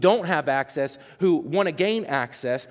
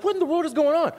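mock bewilderment; 11 semitones higher, faster than usual. Retold bewildered reaction.

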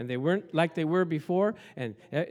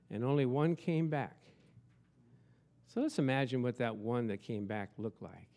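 soft, hushed lead-in; gentle guided visualization; guided imagining; 5 semitones lower, 6 dB quieter than usual. Acted. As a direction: quietly invite them to picture it. Leads the congregation to imagine.

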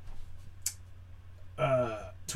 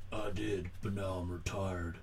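put-on weary old man; 6 semitones lower, 9 dB quieter than usual. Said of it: "scene in any movie".

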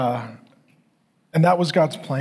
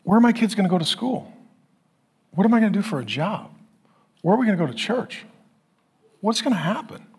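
quick, raised-pitch worried questions; acted-out fretting, higher and quicker; anxious questions; 5 semitones higher, faster than usual. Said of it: "I was anxious".

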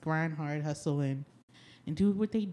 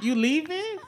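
high, far-off plant voice; the plant asking if she is leaving; plant's voice; 10 semitones higher, 4 dB louder than usual. Pretend. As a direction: voice the plant, hurt and surprised. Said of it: "He was like".

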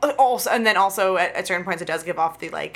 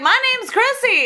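high-pitched mock girlish call; giddy girl character; 4 dB louder than usual. Podcaster retelling the beach chase, high giddy impression. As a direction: call it out high, playing a carefree running girl. Acting out running girl in film.

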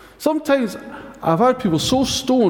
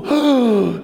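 a hammed-up shocked gasp; a friend's mock shocked gasp; 5 semitones higher than usual. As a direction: gasp as if scandalised. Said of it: "They'll go".

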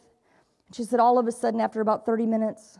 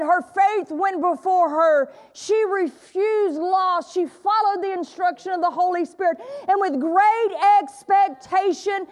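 higher, slow, impassioned preaching; preaching at full force; 7 semitones higher, slower than usual. Acted. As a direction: build the sermon to a fervent climax. Preaching climax.

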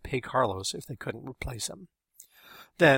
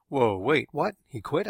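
louder, startled double-take; surprised; 4 semitones higher, 5 dB louder, slower than usual. A narrator's reading. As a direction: react in surprise, jumping in on the first word. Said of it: "I asked, surprised".

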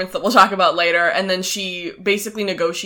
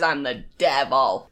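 low, slow mock devil voice; menacing devil; 6 semitones lower, 3 dB quieter, slower than usual. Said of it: "He says".

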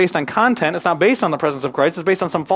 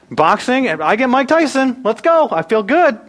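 pumped up; 8 semitones higher, 4 dB louder, slower than usual. Pretend. Pumped about a Mike Tyson partner.

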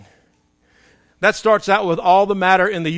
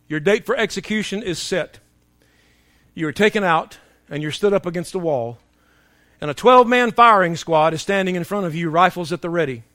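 ordinary talk, nothing marked

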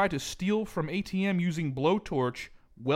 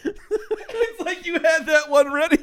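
laughing through the words; 13 semitones higher, 7 dB louder, slower than usual. Real, not acted. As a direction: speak while cracking up. Laughing at the pun.

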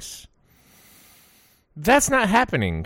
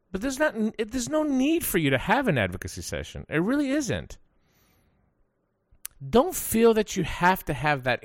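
emphatic rant; 8 semitones higher than usual. Real, not acted.

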